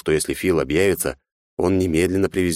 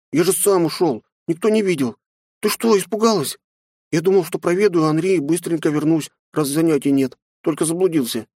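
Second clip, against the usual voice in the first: put-on high innocent boy; child's voice; 11 semitones higher than usual. A narrator's reading. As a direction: explain like a ten-year-old. Boy explains himself. (детский голос)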